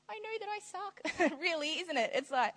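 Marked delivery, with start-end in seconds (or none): 0.1-0.9 high, small, pitiful little voice; mock pitiful prayer. Preacher voicing a sinner's prayer, high and pitiful. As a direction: whimper it to God like a guilty kid.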